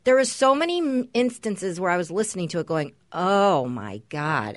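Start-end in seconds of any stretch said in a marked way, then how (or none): 3.1-4.6 retold appalled reaction. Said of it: "I was offended and appalled".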